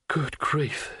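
soft, gasped astonishment. Acted. Wizard staring at the fallen thief, gasping. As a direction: let it out softly on a gasp. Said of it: "he gasped".

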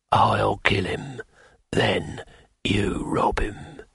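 slow, hushed assassin murmur; low hushed plotting. A narrator's reading. Assassin to innkeeper, a slow hushed plan. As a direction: lay out the plan in a near whisper.

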